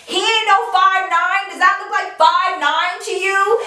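retelling a friend's loud, indignant call-out; loud outburst. Retold or imitated. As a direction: act out her getting loud. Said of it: "her voice got so loud".

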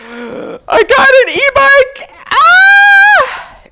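gasp, then a high, loud squeal of joy; thrilled shriek. Real, not acted. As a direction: burst out screaming with delight. Reveals birthday e-bike gift.

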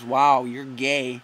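slow, drawn-out robotic taunt; mocking game message voice. Prank caller imitating his Xbox's taunting message, slow and mock-robotic. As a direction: stretch it out like a smug machine voice. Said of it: "it just says".

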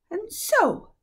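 cold triumphant witch. A narrator's reading. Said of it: "continued the witch".

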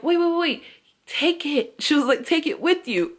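high, pressing wait wait; high eager insistence pressing the gift; urging stranger voice. Retold or imitated. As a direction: insist she takes it, voice up high. Stranger urging her to take it.

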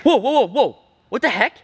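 shocked by what the cat said; startled. Real, not acted. Vlogger startled by the app's strange reply, high spooked whoas. App gives a creepy answer.

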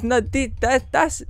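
sputtering in mock shock; shocked barmaid. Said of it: "She goes".

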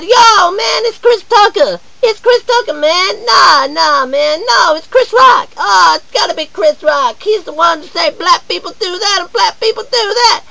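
very high excited shouting mimicry; mocking bystanders. Persona mimicking excited strangers, high mock shouting. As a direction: squeal it like gawking fans. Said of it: "everyone's like".

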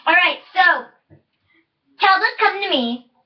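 ordinary delivery

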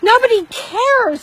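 loud slow taunt. Real, not acted. Drunk woman taunting neighbor.

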